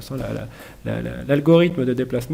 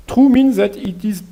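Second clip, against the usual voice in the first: slow, raised English-accent reading; 7 semitones higher, 6 dB louder, slower than usual. Shown as a demonstration. Teacher explaining a boolean to learners, in a put-on English accent. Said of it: "avec mon super accent anglais".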